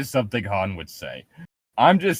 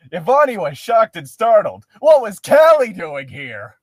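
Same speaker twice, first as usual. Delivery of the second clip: dramatic reading; 5 semitones higher, 5 dB louder than usual. Acted.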